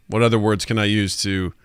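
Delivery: usual speaking voice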